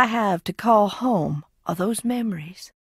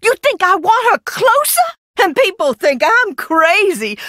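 much higher, louder bitter cry; bitter outburst; 10 semitones higher, 3 dB louder than usual. Real, not acted. Scorning the idea of missing her dead sister, high bitter outburst. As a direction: throw it back in her face.

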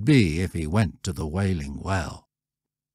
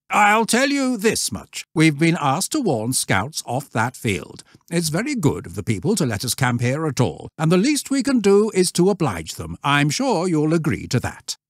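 higher, firm but fair; reasonable master; 8 semitones higher than usual. A narrator's reading. Scoutmaster explaining the rule to the troop, firm and higher. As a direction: explain it to the boys as their leader.

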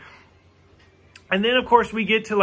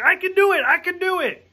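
an eager mock yell, much higher; imitating Chekhov yelling; 10 semitones higher than usual. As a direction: shout it excitedly while running through the ship. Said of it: "he runs through the ship yelling".

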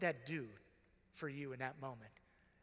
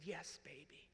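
tearful soft answer; 7 dB quieter, slower than usual. Retold or imitated. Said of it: "I said".

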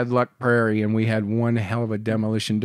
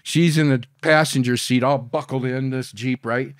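usual speaking voice

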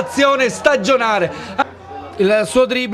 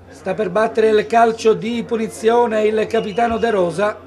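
ordinary delivery

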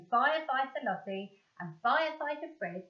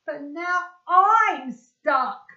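loud, slow, higher cry of dismay; gasping; 7 semitones higher, 13 dB louder, much slower than usual. A narrator's reading. Fred stuck in the pole hole, a loud slow gasp. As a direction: blurt it out stuck and panicking. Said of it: "gasped the firefighter Fred".

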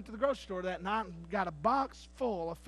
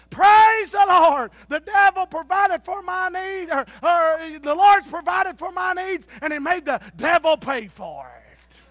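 high, loud, slow triumphant praise; shouted praise; 9 semitones higher, 16 dB louder, slower than usual. Acted. Lady answers the atheist.

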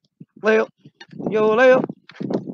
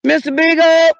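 calling out; 6 semitones higher, 5 dB louder than usual. Real, not acted. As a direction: call the dog's name long and loud. Calling out for lost dog.

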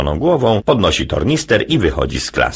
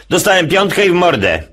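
put-on higher boy's voice; high child voice; 11 semitones higher than usual. Jasiu reporting to dad, high child voice. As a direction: play the little boy up high.

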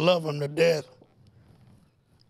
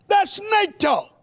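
high, slow, drawn-out cry; denouncing; 13 semitones higher, 4 dB louder, much slower than usual. Acted. Preacher scorning false teaching, high drawn-out cry.

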